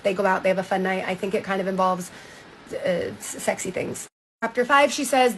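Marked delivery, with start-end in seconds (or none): 2.7-4.1 whispered, softer and a little coy; embarrassed hushed aside. Real, not acted. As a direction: drop to a hush for the naughty bit. Coyly hinting at sex.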